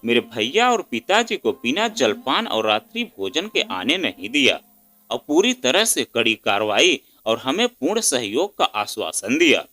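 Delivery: ordinary delivery, nothing marked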